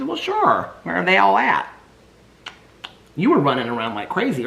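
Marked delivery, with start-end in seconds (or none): 0.0-1.7 high squeaky voice for the puppy; high cartoon puppy voice. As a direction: voice the dog as a little character. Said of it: "He says".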